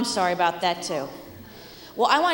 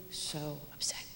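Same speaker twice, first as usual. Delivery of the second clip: whisper; 6 semitones lower, 16 dB quieter than usual. Real, not acted. Describing the child's distress.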